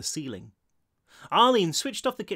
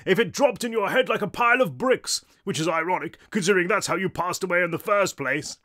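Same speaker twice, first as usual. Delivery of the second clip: loud, raised, haughty English delivery; booming English ghost; 4 semitones higher, 4 dB louder than usual. A narrator's reading. Bald ghost taunting his companion, booming English. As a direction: jeer grandly in an English accent. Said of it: "a deep, booming tenor with what might have been an English accent".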